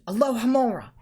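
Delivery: ordinary delivery, nothing marked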